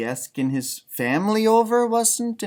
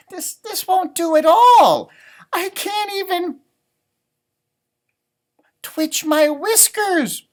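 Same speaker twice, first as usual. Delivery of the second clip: high, loud fretful rabbit; a worried little rabbit; Rabbit fretting; 11 semitones higher, 6 dB louder, slower than usual. Acted. Rabbit fretting over his crowded house, high voice. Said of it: "Rabbit thought".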